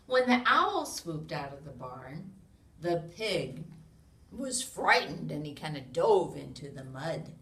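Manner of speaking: loud, lively storybook read-aloud; animated picture-book narration; reading to children. A narrator's reading. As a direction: read it big for a room of little kids.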